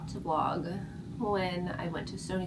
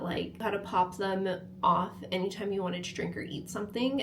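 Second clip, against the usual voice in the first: ordinary delivery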